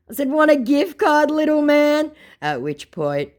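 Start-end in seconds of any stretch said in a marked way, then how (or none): 0.3-2.1 high, loud teasing question; belittling taunt. Retold or imitated. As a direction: tease the little man. Taunting the scammer.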